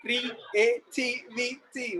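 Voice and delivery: very slow, separated syllables. Shown as a demonstration. Teacher modelling syllables for the class, syllable by syllable. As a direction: break the word into slow beats.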